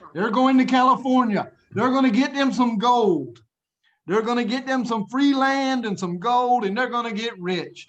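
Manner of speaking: high, loud eager build-up; animated, high-pitched storyteller; excited storytelling. Real, not acted. Storyteller voices travellers' high hopes.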